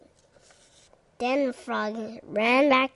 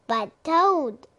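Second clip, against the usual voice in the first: louder higher plea; raised pleading cry; cried out; 5 semitones higher, 5 dB louder than usual. A narrator's reading. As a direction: protest in a raised voice. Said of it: "cried Frog".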